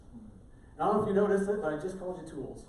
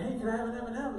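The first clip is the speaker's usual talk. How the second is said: put-on kid asking; 7 semitones higher, 3 dB louder, faster than usual. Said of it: "kids will come in and say".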